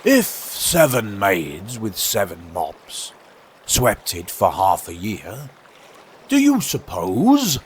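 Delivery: slow, drawn-out storybook walrus; pondering walrus. Acted. Walrus asking carpenter about sweeping the sand, ponderous. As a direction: muse it very slowly.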